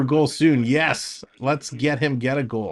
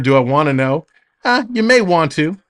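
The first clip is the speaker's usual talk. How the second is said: ordinary talk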